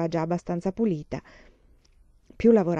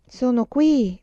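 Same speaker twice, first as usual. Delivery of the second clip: ordinary delivery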